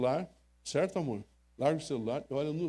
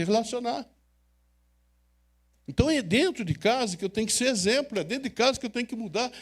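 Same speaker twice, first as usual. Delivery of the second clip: raised, urgent sermon push to the crowd; fervent preaching; 6 semitones higher, 4 dB louder than usual. Acted. Pastor urging couples to care for each other, fervent preaching. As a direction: drive it home like a pulpit appeal. Pastor exhorts parents and husbands.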